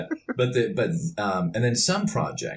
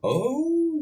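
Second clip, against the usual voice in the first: surprised oh. Real, not acted. Guest recognizes his obscure movie.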